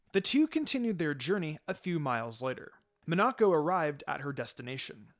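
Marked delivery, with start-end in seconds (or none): none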